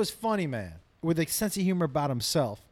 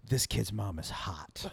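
low aside; 7 semitones lower, 5 dB quieter, slower than usual. Retold or imitated. Catcher whispering dirt behind coach.

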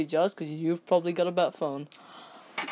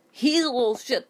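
He's raised high and loud; indignant louder repeat; 7 semitones higher, 4 dB louder than usual. Real, not acted.

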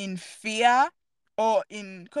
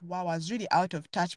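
ordinary talk

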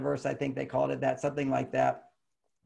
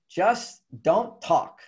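slow, deliberate plea; 5 semitones higher, 4 dB louder, much slower than usual. Retold or imitated. Wife pleading, retold.

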